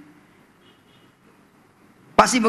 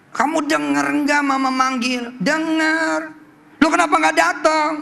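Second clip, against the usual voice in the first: scolding mother; 7 semitones higher than usual. Retold or imitated.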